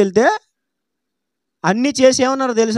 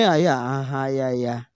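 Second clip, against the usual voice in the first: far lower, much softer meek murmur; meek submission; 13 semitones lower, 9 dB quieter than usual. Retold or imitated. Helpless relative meekly agreeing with staff, low and hushed. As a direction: mumble it humbly, hands folded. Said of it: "నోరు మూసుకొని, చేతులు కట్టుకొని".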